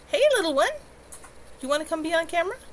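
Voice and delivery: coaxing pet talk. Real, not acted. Owner coaxing her cat onto camera, high sweet pet talk. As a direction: sweet-talk the little animal over.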